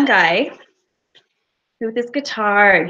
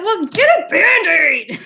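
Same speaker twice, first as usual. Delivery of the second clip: mock shout; 7 semitones higher, 4 dB louder, slower than usual. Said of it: "and he shouted".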